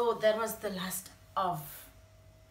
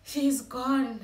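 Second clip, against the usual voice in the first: much louder and higher, breaking out; anguished outcry; 6 semitones higher, 10 dB louder, slower than usual. Retold or imitated. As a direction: cry it out in grief. Realising mother has died.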